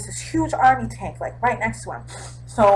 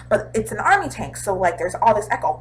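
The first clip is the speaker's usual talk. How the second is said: normal talking voice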